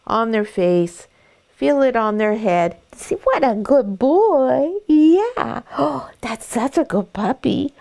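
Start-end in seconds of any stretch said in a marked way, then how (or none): 2.9-7.7 high, slow sing-song praise for the puppy; delighted baby talk to a dog. Shown as a demonstration. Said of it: "when you talk in that sing-song baby voice".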